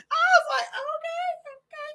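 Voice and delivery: tiny high squeak left over from laughing; breathless amusement. Real, not acted. Grandmother conceding to her daughter, squeaky with laughter.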